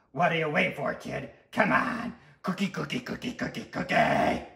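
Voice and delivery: loud, frantic craving; greedy little monster begging for treats; impatient sweet tooth. Acted. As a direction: chant hungrily, building to a rough yell. Tooth clamours for cookies.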